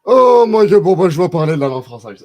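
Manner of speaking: very loud, high outburst that falls away; exclamation exaspérée. Real, not acted. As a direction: cry out, then come down in pitch. Réagit vivement au chat. (exclamation forte et aiguë qui redescend)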